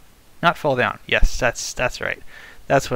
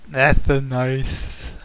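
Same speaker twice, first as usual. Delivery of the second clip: derpy creeper sound; 5 dB louder, much slower than usual. Pretend. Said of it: "That was a derpy creeper sound".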